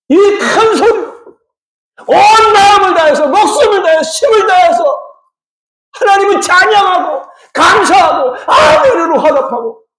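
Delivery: high, fervent exhortation with stretched words; impassioned preaching. Real, not acted. Preacher describing wholehearted praise of God, impassioned. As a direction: preach it with whole-hearted fervour, pitched high.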